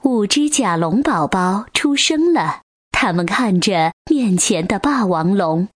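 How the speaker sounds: ordinary delivery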